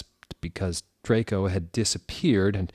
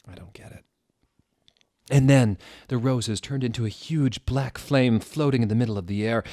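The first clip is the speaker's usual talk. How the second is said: ordinary delivery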